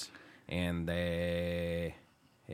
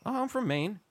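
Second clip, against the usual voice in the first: high-pitched imitation Canadian accent; thick Canadian accent; 11 semitones higher than usual. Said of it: "this guy has a real thick accent".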